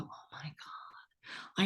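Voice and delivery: breathy hushed gasp of wonder; awed whisper. Retold or imitated. Listener hearing the podcast, whispering in awe. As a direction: whisper it like you can't believe it.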